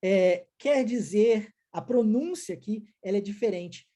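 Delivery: ordinary talk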